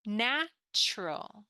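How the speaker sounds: slow "Natural." with the T U said as chur, NAT-chur-al; slow teacher model of "Natural." with a ch sound; slow model word. Shown as a demonstration. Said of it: "pronounced more like this".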